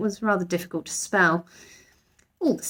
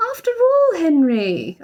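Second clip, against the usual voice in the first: arguing mother; 9 semitones higher, 5 dB louder, slower than usual. A narrator's reading. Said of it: "argued Missus Brown".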